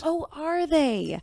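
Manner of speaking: slow, drawn-out skeptical jab; sarcastic doubt. Acted. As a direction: let the doubt drip off it slowly. Chief doubts crew, sarcastic retort.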